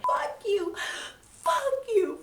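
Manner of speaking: very high, sobbing fuck you; crying curse. Retold or imitated. Maria leaving in tears, high sobbing curse. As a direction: wail it through tears.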